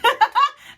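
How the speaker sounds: high, loud cackling laugh; bursting laugh. Real, not acted. Fan admitting her queen worship, loud laughing.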